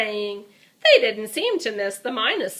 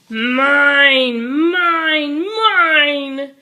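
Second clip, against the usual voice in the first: loud grabby dinosaur roar, higher; roaring mine; 5 semitones higher, 8 dB louder, much slower than usual. Acted. Greedy dinosaur grabbing the toys, roaring loud. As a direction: bellow it like a greedy little monster. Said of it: "he roared to one and all".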